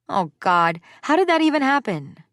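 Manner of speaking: high, loud alarmed disbelief; shocked. Acted. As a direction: blurt it out in alarm. Finding girl with cone on head.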